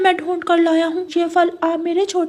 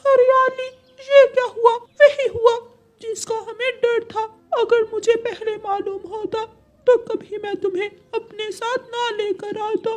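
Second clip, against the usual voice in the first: tearful, higher-pitched lament; crying; 11 semitones higher than usual. Acted. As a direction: wail it through sobs, grieving a lost mate. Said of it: "रोता हुआ मिठू तोता".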